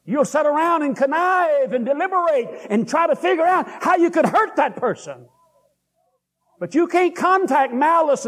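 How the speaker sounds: high, driving pulpit voice, pressing each point home; fervent preaching. Acted. Preacher drives his point home to the church, in a high, fervent voice. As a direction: preach it out full force to the congregation.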